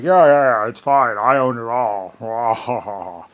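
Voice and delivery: loud, careless mimicry slowing on the last line; mock dismissive. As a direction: brush it off like an arrogant owner. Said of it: "And they'd be like".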